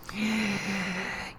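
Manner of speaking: drawn-out soft sigh; long sigh. Real, not acted. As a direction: exhale at length before conceding. Conceding he'd lose the job.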